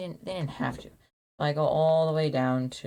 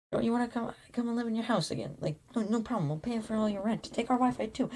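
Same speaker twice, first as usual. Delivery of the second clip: high, sugary-sweet friendly voice; sweet homeowners; 7 semitones higher than usual. Retold or imitated. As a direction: play overly nice new owners offering everything. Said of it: "There's really sweet people".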